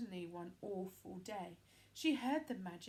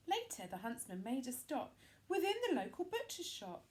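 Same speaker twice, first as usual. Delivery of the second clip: usual speaking voice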